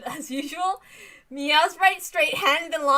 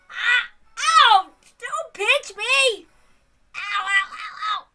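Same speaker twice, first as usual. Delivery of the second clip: pained yelps; 7 semitones higher, 8 dB louder, slower than usual. Acted. Character pinched, crying ow.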